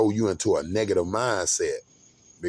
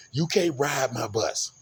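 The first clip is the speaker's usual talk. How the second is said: a raised, stern bus driver telling him off; 6 semitones higher than usual. Retold or imitated. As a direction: lay down the rule like a strict driver. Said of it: "the way he was so stern".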